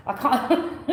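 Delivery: real giggle, words breaking up into laughter; can't get the words out for laughing. Real, not acted. Tarot reader cracking up at her own mock Scottish, laughing.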